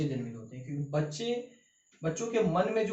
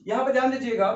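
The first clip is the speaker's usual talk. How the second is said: louder call for attention; emphatic call; 5 semitones higher, 7 dB louder, faster than usual. Real, not acted. Teacher calling students to focus, loud and firm. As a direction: grab the class's attention. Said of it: "teacher calling attention".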